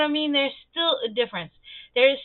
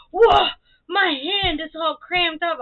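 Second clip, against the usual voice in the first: high, loud whoa into a slow whine; mock-pained child voice, drawn out; complaining little kid; 6 semitones higher, 6 dB louder, slower than usual. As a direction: imitate a worn-out small boy. Voicing boy complaining after stirring.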